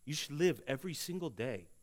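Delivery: normal talking voice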